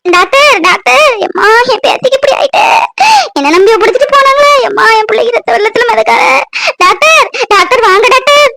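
a panicked grandmother wailing for help; frantic grandmother. Acted. Grandmother bursts into the hospital calling the doctor, tearful wail.

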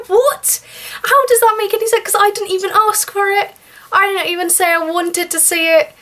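real exasperation, voice up high; indignant rant. Real, not acted. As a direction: rail against it, pitched high. Indignant rant about the pressure.

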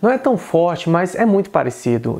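ordinary talk, nothing marked

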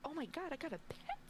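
a mock-giddy squeal of joy; retold excitement. Retold joy at rabbit pet.

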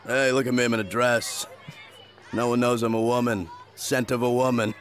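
ordinary talk

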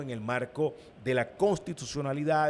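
ordinary talk, nothing marked

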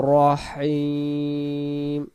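রহিম chanted as "ro-heem", very long ending; correct recitation. Shown as a demonstration. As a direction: hold the "ee" of রহিম slowly. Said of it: "রাহিম আমরা বলবো না".

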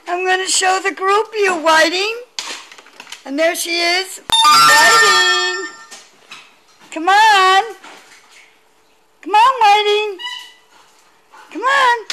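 high, coaxing sing-song to the dog; high dog-calling voice. Real, not acted. Owner calling her dog on the video call, high and coaxing.

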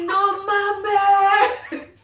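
half laugh, half wail of disbelief. Retold or imitated. Retelling her friend hanging up, laughing mock wail. Retold disbelief after hang-up.

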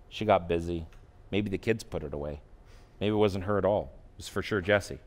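soft, low, gentle correcting thought; calm new inner voice. Retold or imitated. The gentle new voice answering his angry thought, soft and calm. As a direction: settle the anger quietly. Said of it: "another voice happened".

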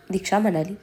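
usual speaking voice

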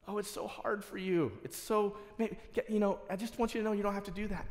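high, fast, coaxing plea; pleading friend. Acted. Peter pleading with Jesus to avoid suffering, high and fast. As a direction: beg him kindly to take the easy way.

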